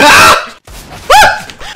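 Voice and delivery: high, loud shriek; startled scream. Real, not acted. Gamer finding someone behind them, startled scream. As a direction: scream in sudden shock.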